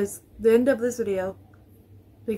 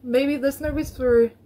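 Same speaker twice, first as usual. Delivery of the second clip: nervous; 3 semitones higher, 5 dB louder than usual. Real, not acted. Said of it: "it's been a long time since I've been this nervous".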